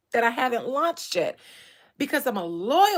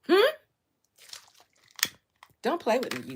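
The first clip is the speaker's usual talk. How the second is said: ordinary delivery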